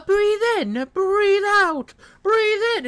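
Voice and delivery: high, robotic mock TTS readout; mocking text-to-speech. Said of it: "like, text to speech".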